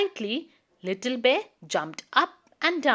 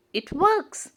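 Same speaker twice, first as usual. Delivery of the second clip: a higher, delighted cry; a mild happy shout of success; excited cry; 7 semitones higher than usual. Acted. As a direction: sound pleased it worked. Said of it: "cried Little Bear".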